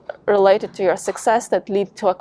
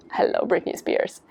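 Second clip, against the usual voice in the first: whispered aside; 5 dB quieter than usual. Acted. Playful aside about pop songs.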